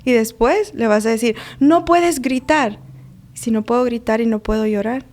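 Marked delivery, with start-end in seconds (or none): 1.6-2.8 higher, louder, slow scold; strict parent scolding. Retold or imitated. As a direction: firmly forbid the child to shout, dragging each word. Mother scolding child, acted quote.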